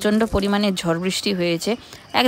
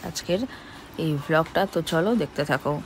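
talking around a toothbrush; while brushing teeth; 7 dB quieter than usual. Real, not acted. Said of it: "brush করতে করতে".

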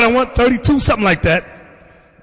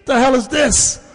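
ordinary talk

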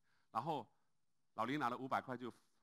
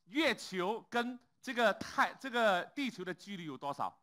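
smug question; 8 semitones higher, 8 dB louder, slower than usual. Retold or imitated. Lawyer asks smug question.

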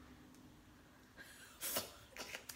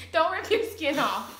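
normal talking voice

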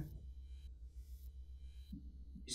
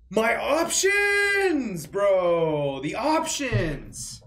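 mock despair; 7 semitones higher, 7 dB louder, much slower than usual. Dramatic despair over game choices.

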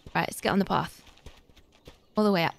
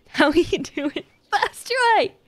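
higher repeat broken by laughs; laughing; 8 semitones higher, slower than usual. Real, not acted. Greeting a chat viewer again, laughing. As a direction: laugh your way through it.

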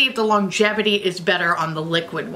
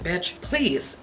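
softer, dismissive brush-off; 3 semitones lower, 6 dB quieter than usual. Retold or imitated. Answering the pushy text messages, soft and dismissive. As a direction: wave it away quietly. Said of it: "And it's like".

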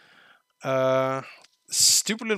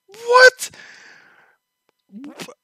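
sudden very high loud shriek; shocked what; 13 dB louder than usual. Real, not acted. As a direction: jolt of disbelief. Shocked by line.

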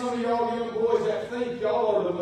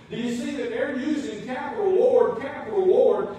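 normal talking voice

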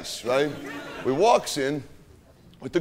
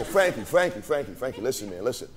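soft coaxing; 7 semitones lower, 3 dB quieter than usual. Retold or imitated. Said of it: "I go".

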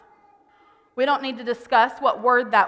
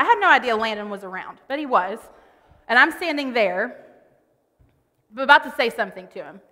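ordinary talk, nothing marked

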